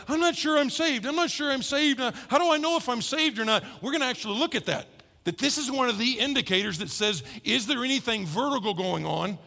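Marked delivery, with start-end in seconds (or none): 0.1-3.6 higher, worried imitation of an unsure believer; a mock-anxious, higher voice asking whether they're saved; anxious doubter. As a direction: play a fretting churchgoer, voice up high. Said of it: "Some of you are like".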